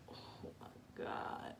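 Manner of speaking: slow breathless whisper; slow hushed oh my God; whispered dread. Retold or imitated. Dreading talk with mom.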